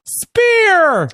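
high-pitched commentator's holler; Michael Cole. Acted. Host mimicking an announcer as the tackle lands, high excited yell. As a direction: stretch the one word into an excited ringside call. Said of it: "That's my impression of Michael Cole calling out".

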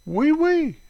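very high, piping oui oui; jokey high-pitched French yes; squeaky French catchphrase. Pretend. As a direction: pipe it up high, playfully.

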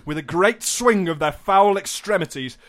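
grand, rising storyteller delivery; theatrical relish on every villainous detail; mock-dramatic narration. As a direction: declaim it like a dire warning. Reads scam description dramatically.

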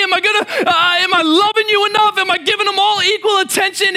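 high, strained anxious questions; overwhelmed dad fretting out loud; anxious worrying. Retold or imitated. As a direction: voice a panicking dad's inner doubts. Anxious dad's racing thoughts.

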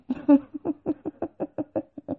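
long, high burst of laughter; real laugh. Real, not acted. Laughing at own comeback to the nurse, real laugh. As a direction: crack up in a high, running giggle.

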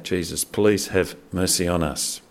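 normal talking voice

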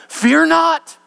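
high, loud call; ringing command. Acted. Angel calls out to the fearful believer, loud and high. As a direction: declare it from above.

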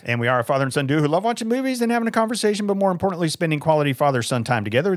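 fast, high upbeat host read; peppy father-son podcast opener; show intro. Acted.

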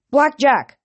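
loud shout, pitch jumps up; yell. A narrator's reading. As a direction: call the horse across the bridge. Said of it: "I yelled".

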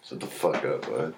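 low, quiet gruff put-down; mock gruff dismissal. Shutting down a friend's joke, low gruff mock dismissal. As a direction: drop it low and growl it out.

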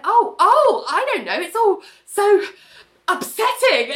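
very high, hammed-up ditzy distress; mock fluster. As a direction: overplay a flustered, fake-upset gush. Ali acting flustered, overplayed.

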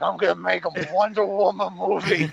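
lightly put-on tipsy director; a hint of tipsy bravado; mock drunk. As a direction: imitate a drunken boast. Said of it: "like, drunk after hearing".

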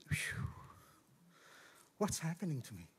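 hushed exhale, then low soft question; shaken self-questioning. Retold or imitated. Man alone in his room asking himself, hushed and overwhelmed. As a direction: let out a breath and murmur to yourself.

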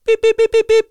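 loud, high-pitched repeated alarm beeps; imitated alarm beep. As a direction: beep like a shop security gate. Imitating a shop security alarm.

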